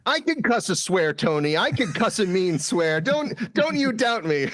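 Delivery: high-pitched in-character boast; mock-indignant character. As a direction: protest in a high, put-on voice.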